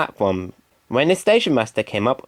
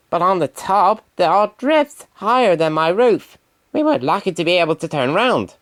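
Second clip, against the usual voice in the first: lightly voiced bus, a little higher; fretful bus warning, touch higher; Bertie the bus; 7 semitones higher than usual. Bertie keeps talking to Thomas.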